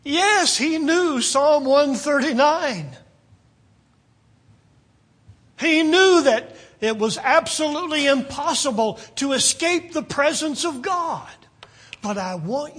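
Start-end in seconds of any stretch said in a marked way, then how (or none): 0.0-11.3 high, loud, ringing answer to his own question; raised pulpit voice, driving the certainty home; emphatic preaching. Real, not acted. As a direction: proclaim it forcefully to the congregation.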